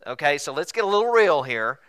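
normal talking voice

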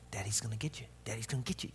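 playful low growl. Retold or imitated. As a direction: creep up on the toddler in a hushed, playful tone. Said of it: "I'll be like".

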